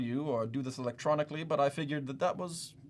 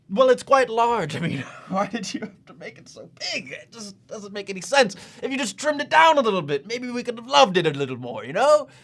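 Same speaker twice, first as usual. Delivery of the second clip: higher, louder flustered complaint; exasperated; 7 semitones higher, 8 dB louder than usual. Acted. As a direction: let the polite front slip into exasperation. Exasperated over the submission's size.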